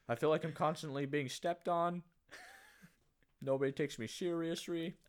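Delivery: lightly voiced laughing complaint; mock tiny man. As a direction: whine like a shrunken man. Playing a downsized man.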